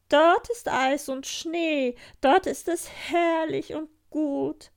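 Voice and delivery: schwärme laut und langsam vom Schnee. Acted. Rentier schwärmt vom Norden. (Rentier schwärmt Gerda laut vom Norden vor)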